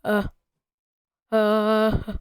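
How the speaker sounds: tongue held still, speech blocked; talking with tongue held still. Shown as a demonstration. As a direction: try to speak without moving tongue. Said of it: "I'll try it right now".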